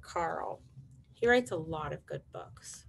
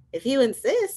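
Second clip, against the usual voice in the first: high, louder reply, lifting at the end; sparrow; 7 semitones higher, 6 dB louder than usual. Acted. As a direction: accept politely in a high bird voice. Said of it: "said the sparrow".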